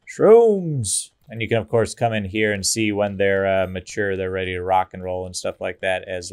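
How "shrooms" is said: SHROOMS called out high, loud and drawn out; playful cheer. Real, not acted. Player cheering a mushroom harvest, playful cheer. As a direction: sing out the find with glee.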